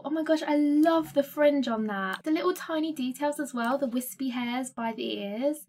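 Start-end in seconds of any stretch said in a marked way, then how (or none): none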